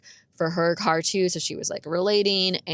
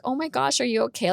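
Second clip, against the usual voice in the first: higher-pitched, lightly voiced concern; retold concerned friend; 6 semitones higher than usual. Friend sees her crying, lightly imitated concern. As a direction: pitch up a worried check-in. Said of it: "My friend's like".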